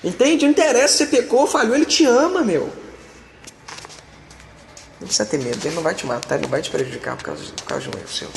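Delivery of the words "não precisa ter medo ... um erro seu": gentle reassurance. Real, not acted. Pastor softly comforting a fearful believer, hushed and low. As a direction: drop to a soft, low hush.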